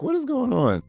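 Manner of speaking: high, bewildered "what is going on"; baffled. Retold or imitated. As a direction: sound thrown by something weird. Retelling her own baffled reaction.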